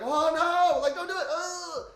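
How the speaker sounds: high, loud panicked plea; yelling at the screen. Retold or imitated. Viewer yelling at a horror movie character, panicked plea. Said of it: "you're like".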